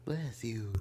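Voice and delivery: soft breathy bless you; whispered. Real, not acted. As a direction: whisper it gently. Whispered after sneeze.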